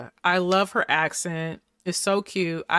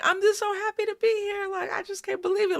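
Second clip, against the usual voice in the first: much higher, giddy and breathless; gushing happy quote; 13 semitones higher, faster than usual. Retold or imitated. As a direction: mimic a starstruck newcomer gushing. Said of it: "see someone saying".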